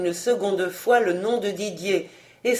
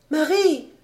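higher-pitched, mild cry; calling out; 8 semitones higher than usual. A narrator's reading. Young lady at the window calls to Marie, crying out. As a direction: call her name as from a window. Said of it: "cria-t-elle".

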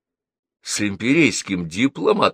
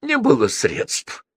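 sniffling consul; 5 semitones higher, slower than usual. A narrator's reading. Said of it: "хлюпнул носом консул".

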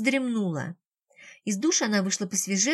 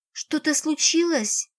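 higher, slow and anxious; sudden worry, drawn out slowly; fearful question; 5 semitones higher, slower than usual. A narrator's reading. Lera asks Karatov at the door, suddenly frightened. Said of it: "плеснулся страх в её глазах".

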